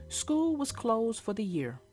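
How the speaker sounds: usual speaking voice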